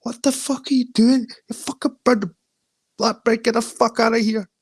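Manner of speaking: high-pitched, bad English accent; badly imitated English accent in a squeaky high voice; Antonio accent. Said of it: "That's a bad English accent".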